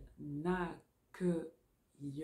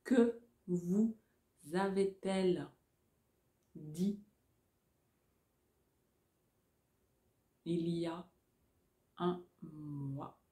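dictating. Shown as a demonstration. Dictation chunks.

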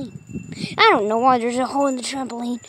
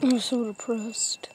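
whispered pretend gloom; mock sad whisper; 5 semitones lower, 12 dB quieter than usual. The armless character mopes about being sad, soft whisper. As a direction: breathe it out as a play-acted sulk.

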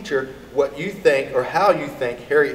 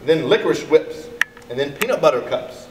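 ordinary delivery, nothing marked

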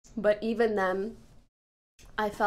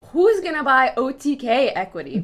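loud retort; 3 semitones higher, 11 dB louder than usual. Real, not acted. Loud comeback in playful argument.

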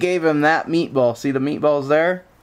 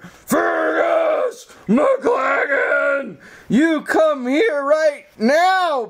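high, slow drawn-out call of the full name; mock exasperated yell; much slower than usual. Owner losing patience calling the dog inside, mock exasperated. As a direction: stretch out the name like a fed-up owner. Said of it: "exasperated full-name call".

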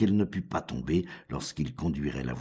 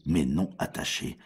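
usual speaking voice

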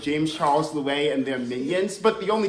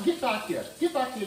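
usual speaking voice

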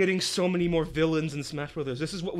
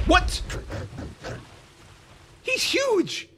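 shocked; 12 semitones higher, 3 dB quieter, much slower than usual. Real, not acted. Shocked at the character's size.

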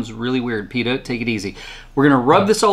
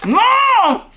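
much higher, louder monster wail; Cookie Monster voice; 5 dB louder than usual. Acted. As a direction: cry out like the cookies are taken. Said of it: "a Cookie Monster impression".

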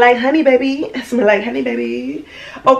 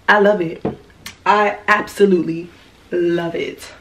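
normal talking voice

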